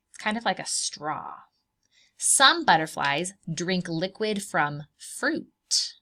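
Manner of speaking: plain reading voice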